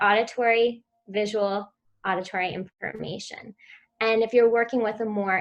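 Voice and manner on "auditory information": normal talking voice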